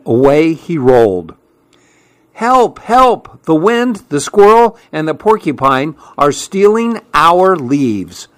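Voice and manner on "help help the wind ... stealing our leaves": high-pitched storybook cry for help. A narrator's reading. Fletcher cries out as animals steal the leaves, alarmed. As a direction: call out high in alarm, easing towards the end. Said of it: "cried Fletcher".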